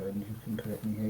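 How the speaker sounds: a fast whisper under the breath; whispered aside. Real, not acted. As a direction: slip in a quick whispered aside. Quick aside during the debate.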